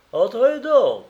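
high, loud call across the yard. Acted. Praskovia calls to the suitor at the gate, high and loud. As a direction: call him in from the door. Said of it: "lui cria Paskovia".

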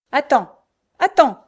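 very loud, high outburst; crying out. A narrator's reading. Raging Frochard has a new idea, crying out. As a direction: shout it out in a rage. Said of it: "s'écria-t-elle".

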